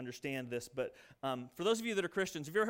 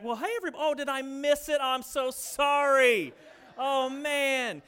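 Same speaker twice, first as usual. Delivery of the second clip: higher, loud, falsely apologetic great-aunt; breezy great-aunt; 4 semitones higher, 5 dB louder than usual. Retold or imitated. Great-aunt Jenny Ellen returning after the dishes, mock-innocent apology. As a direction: play innocent, as if you just missed the chores. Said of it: "Jenny Ellen came back".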